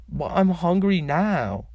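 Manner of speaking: slow whiny plea for food; whining. A narrator's reading. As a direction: stretch it out like a whiny kid. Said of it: "Niall whines".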